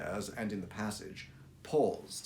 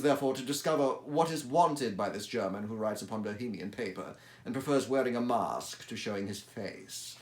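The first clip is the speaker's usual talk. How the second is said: normal talking voice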